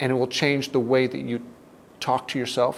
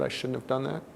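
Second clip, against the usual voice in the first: quiet, sunken regret over I shouldn't have done that; heavy self-blame; 5 dB quieter than usual. Shown as a demonstration. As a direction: say it as a crushing self-rejection. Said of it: "the size of the emotion could be huge".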